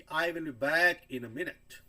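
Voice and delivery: "I will be back in a minute" slow, with the fall on "minute". Shown as a demonstration. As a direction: say it slowly, voice dropping at the end. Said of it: "मैं फिर से बोल रहा हूँ".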